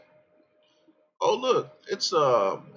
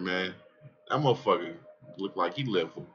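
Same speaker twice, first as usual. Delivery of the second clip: ordinary talk, nothing marked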